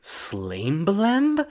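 Slambelemb echoed back slowly with a questioning rise; baffled echo of Slambelemb; puzzled repeat of Slambelemb. Retold or imitated. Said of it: "And he says".

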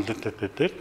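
soft "dit" beeps like a ringing phone; soft imitated dial tones. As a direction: mimic the tones quietly.

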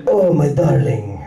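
seduce her like a lusty vampire count; horny Dracula. Acted. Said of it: "It's like a horny Dracula".

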